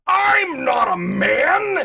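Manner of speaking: high, loud, drawn-out protest; indignant retold yell. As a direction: wail it slowly in outraged protest. Retelling her friend's indignant reply.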